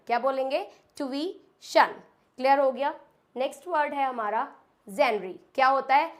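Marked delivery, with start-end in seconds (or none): 1.0-1.9 ट्यूशन broken up as tu-wee, shun; correct pronunciation. Shown as a demonstration. Teacher drilling learners, ट्यूशन split as "tu-wee, shun". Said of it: "first इसको बोल सकते हैं".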